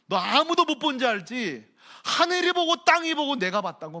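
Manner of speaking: high, loud accusing rebuke; God's rebuke. Acted. God rebuking Cain, high and loud. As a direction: call it down like an angry God. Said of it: "말하는 거예요".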